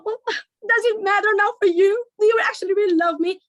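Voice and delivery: hammed-up wife dodging with questions; wife deflecting husband with questions, hammed-up; dramatic wife. Acted quarrel, wife dramatic.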